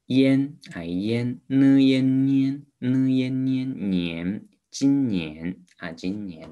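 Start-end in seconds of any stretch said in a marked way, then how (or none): 0.1-3.5 烟 and 呢烟 sounded high and loud; sounding out words. Shown as a demonstration. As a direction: build up 年 from 呢 plus 烟. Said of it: "来看一下".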